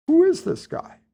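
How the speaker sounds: high, puzzled question from the boss; a lightly put-on boss voice asking who this is. General asking his aide about the letter, in a high put-on voice. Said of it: "He says".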